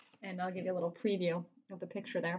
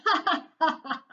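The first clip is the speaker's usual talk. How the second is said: loud high villain cackle; hammed-up gloating ha ha ha; evil laughter; 9 semitones higher, 9 dB louder than usual. A narrator's reading. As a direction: laugh at their misery. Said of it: "Mister Krupp laughed and laughed".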